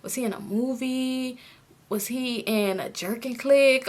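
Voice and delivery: slow, soft puzzling; hushed, drawn-out pondering; musing to oneself. Retold or imitated. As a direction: wonder quietly, searching your memory. Acting out her puzzled thoughts.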